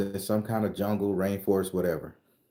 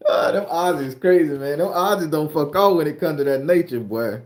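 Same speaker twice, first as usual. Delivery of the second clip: louder, higher amused exclaiming; excited; 7 semitones higher, 7 dB louder than usual. Real, not acted. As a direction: cheer them on, still laughing. Laughing, loud praise of Aussies.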